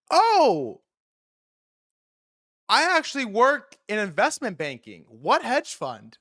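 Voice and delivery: mock bar girl, high and eager; high girly voice. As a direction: play her bubbly and a little nosy. Girl voiced, impressed then probing.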